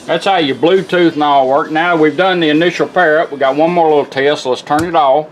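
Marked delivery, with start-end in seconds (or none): none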